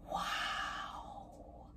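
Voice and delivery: breathy whispered awe; whispered wow. A narrator's reading. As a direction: breathe it out in wonder. Awed at butterfly Maisie.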